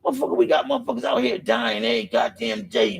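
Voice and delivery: usual speaking voice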